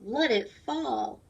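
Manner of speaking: ordinary talk, nothing marked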